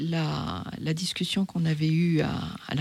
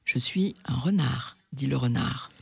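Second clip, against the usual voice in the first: story read aloud. A narrator's reading. Reading Le Petit Prince aloud to the audience, in a storytelling voice. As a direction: read the tale to the audience, calmly. Reads story narration and fox.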